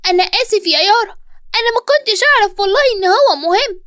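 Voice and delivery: put-on high-pitched little boy apologizing; sad, apologetic child. A narrator's reading. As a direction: plead sorry in a high child's voice. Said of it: "وعلي قرب مني بزعل".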